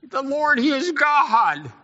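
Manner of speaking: high, loud, slow proclamation; mock proud Elijah. Preacher recalls Elijah's bold cry the day before, high. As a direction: boom it out like a triumphant prophet.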